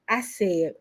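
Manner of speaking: ordinary talk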